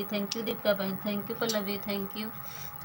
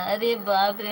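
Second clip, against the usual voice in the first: louder exclamation of mock shock; mock dismay; 7 dB louder than usual. Real, not acted. After viewers call her greedy, a louder mock-shocked cry. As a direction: throw up your hands in playful dismay. Reacts to being called greedy.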